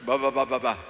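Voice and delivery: loud mock baby babble ba ba ba; baby babbling. Shown as a demonstration. Pastor imitating a baby's first word, loud babble. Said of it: "¿qué palabra sea?".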